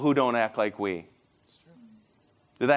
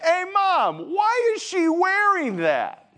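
put-on high, drawn-out kid asking Mom; 14 semitones higher, slower than usual. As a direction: blurt it out like a little kid. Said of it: "so you might say something like".

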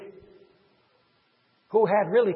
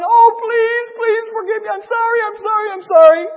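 loud, very high wailing plea; acted sobbing apology, piled up in a rush; mock crying; 12 semitones higher, 11 dB louder than usual. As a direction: beg hysterically, hammed-up. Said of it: "he continued crying".

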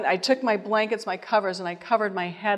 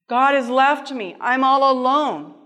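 higher, louder lament of abandonment; acted-out anguish, voice raised; despairing cry; 5 semitones higher, 6 dB louder than usual. Acted despairing thoughts in hospital.

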